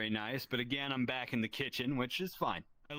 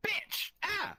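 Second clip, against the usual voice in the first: sudden high pained yell; shrieked curse; retold yell. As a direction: yelp it out like grease just hit you. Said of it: "I yell out".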